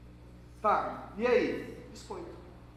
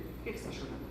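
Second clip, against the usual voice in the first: whispered question; 7 semitones lower, 13 dB quieter, faster than usual. Acted. Takes him aside, asks softly.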